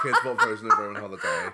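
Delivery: fast, laughing through the words. Real, not acted. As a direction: giggle while saying it. Laughing, repeating the joke.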